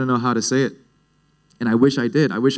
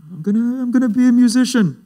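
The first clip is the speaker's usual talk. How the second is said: nervous announcement, pitched way up; high-pitched blurting; 13 semitones higher, 3 dB louder than usual. Acted. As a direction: blurt it out high to your dad. Acts out blurting news to dad.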